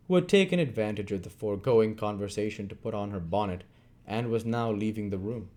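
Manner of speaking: ordinary delivery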